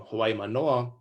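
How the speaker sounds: usual speaking voice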